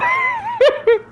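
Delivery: very high squealing cackle; shrieking laugh. Real, not acted. Streamer cackles at the guest's excuse.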